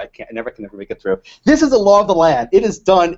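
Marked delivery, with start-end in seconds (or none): 1.4-3.2 louder, emphatic quoted proclamation; hammered-out imitation of a president's pronouncement; mock presidential declaration. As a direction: declare it firmly, without laughing this time. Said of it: "He said, he said".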